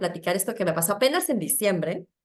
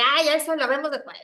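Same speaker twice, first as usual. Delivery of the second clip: high, brushing it aside; dismissive brush-off; 7 semitones higher, 5 dB louder than usual. Retold or imitated. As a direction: wave the problem away. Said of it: "yo era la que reaccionaba". (tono desdeñoso y agudo)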